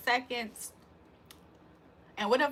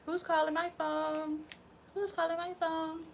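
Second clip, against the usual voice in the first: high playful voice; 7 semitones higher, 6 dB quieter than usual. Acted. Odd voice on incoming call.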